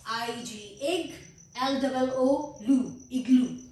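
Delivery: teacher breaking igloo into इग and लू; syllable by syllable. Shown as a demonstration. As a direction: slowly split into syllables, then the whole word. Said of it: "इसके दो syllables हैं".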